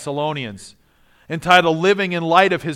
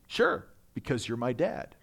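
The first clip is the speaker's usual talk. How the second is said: soft, low, matter-of-fact imitated reply; acted unbothered calm, dropping quiet; remarkable calm; 6 semitones lower, 9 dB quieter than usual. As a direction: answer Dad calmly, as if obvious. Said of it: "He responded with kind of a remarkable calm".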